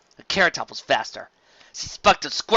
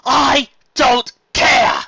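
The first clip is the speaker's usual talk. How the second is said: very slow, loud and higher; defiant; 12 semitones higher, 11 dB louder, much slower than usual. Acted. Carrot Top defies Flam, slow and loud. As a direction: stretch each word in defiance.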